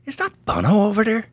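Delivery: put-on a starstruck boy pointing out stars; awed kid. Star-struck kid asking dad.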